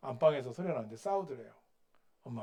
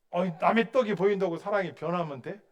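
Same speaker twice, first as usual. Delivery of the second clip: challenging shout; 4 semitones higher, 8 dB louder than usual. Real, not acted. Preacher challenges husbands.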